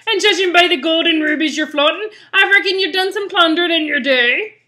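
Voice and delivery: rally the crew as a rough pirate. Acted. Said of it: "doing this pirate voice".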